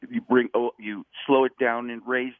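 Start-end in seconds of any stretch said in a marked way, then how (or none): none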